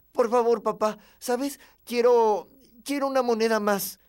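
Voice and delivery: put-on high, loud child begging dad; insistent, high-pitched plea; pleading. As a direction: beg urgently like a desperate little boy. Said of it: "le supliqué".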